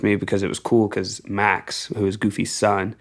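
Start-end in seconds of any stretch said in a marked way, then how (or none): none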